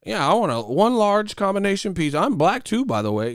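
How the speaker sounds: high, chirpy put-on caller voice; mock poser customer. Poser phoning in a pizza order, high mocking voice. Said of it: "you don't just call a Pizza Hut".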